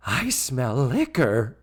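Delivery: mock suspicious, slow gasping sniff; slow accusing line after a gasp; Mrs. Garrett imitation. Host as Mrs. Garrett leaning into Tootie, mock suspicion. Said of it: "where Missus Garrett leans into Tootie".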